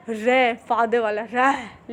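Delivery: रे stretched very slowly; drawn out. Shown as a demonstration. Teacher demonstrating the रे sound, very slow. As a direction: hold the रे long. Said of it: "लेकिन रे इतना तेज नहीं आएगा".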